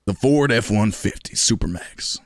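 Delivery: slow country truck-ad announcer; truck commercial voice. Shown as a demonstration. Streamer demonstrating a truck commercial, slow announcer voice. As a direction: sell it slowly like a pickup commercial. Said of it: "I could do a, a, a truck commercial".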